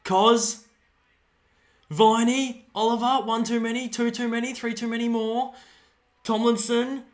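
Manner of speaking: high, loud running call of the play; excited calling. Real, not acted. Fan calling the passage of play at the TV, excited and high. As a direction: call every touch like you're on the edge of your seat. Live excited watching commentary.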